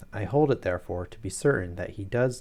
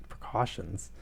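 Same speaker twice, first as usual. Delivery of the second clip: Precautions? repeated dryly with a falling disbelieving tone; sarcastic echo; 5 dB quieter, slower than usual. Real, not acted. Reader mocking the character's excuse, sarcastic echo. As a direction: scoff at the word Precautions? as an understatement. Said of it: "You're pointing guns at people".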